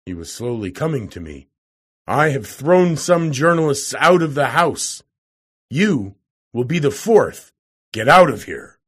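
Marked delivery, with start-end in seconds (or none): 2.1-8.8 loud, forceful warning; raised, menacing order to leave; angry threat. A narrator's reading. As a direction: threaten the intruder, building louder to the end. Professor threatens to throw out visitor.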